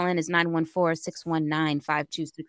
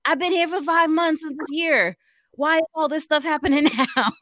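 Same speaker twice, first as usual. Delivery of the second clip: higher, louder fed-up groan; venting about pre-vacation pressure; exasperated venting; 7 semitones higher, 5 dB louder than usual. Real, not acted. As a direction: let the frustration boil over. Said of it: "venting exasperation".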